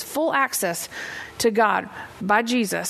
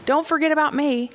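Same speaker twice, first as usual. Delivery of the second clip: pleading Jesus; 6 semitones higher than usual. Acted. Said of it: "he's saying".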